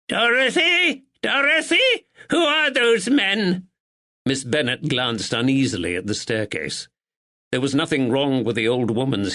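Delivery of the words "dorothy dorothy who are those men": put-on high, slow cracked old voice; old mother demanding answers from upstairs; frail old woman calling. A narrator's reading. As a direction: call out sharp and suspicious.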